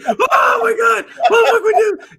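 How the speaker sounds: hammed-up hysterics of helpless buddies; mock panicked screaming. Medic imitates his squad losing it under fire, mock hysterical screaming. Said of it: "they lost their shit".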